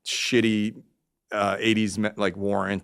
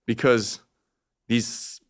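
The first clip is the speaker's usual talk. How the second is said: ordinary delivery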